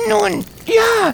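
put-on very high, hesitant child's voice; a kid trying to wriggle out; evasive boy. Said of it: "versuchte Tommy sich herauszureden".

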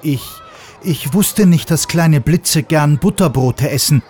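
plain reading voice

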